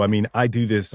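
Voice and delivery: ordinary talk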